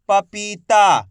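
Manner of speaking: पपीता sounded out slowly with long vowels; drawn-out long ई. Shown as a demonstration. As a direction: stretch पी and ता in पपीता. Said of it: "खींच के पढ़ा जाता है".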